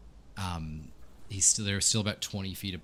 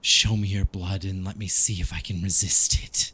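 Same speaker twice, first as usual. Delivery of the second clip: loud, forceful demand from a tempted vampire; conflicted vampire character; 8 dB louder than usual. Acted. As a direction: demand it as a test of your will. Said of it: "and he says".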